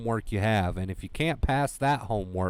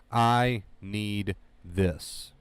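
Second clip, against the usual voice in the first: very slow affirmation; 2 semitones lower, much slower than usual. Shown as a demonstration. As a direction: draw each word out, very slowly. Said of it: "those are your three words".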